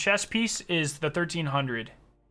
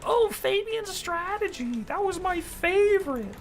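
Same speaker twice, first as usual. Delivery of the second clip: high, slow whiny mock of old-game fans; slower than usual. Streamer mocking fans who miss old exotics, high whiny voice. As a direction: sneer it like a nostalgic fan. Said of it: "They're always like".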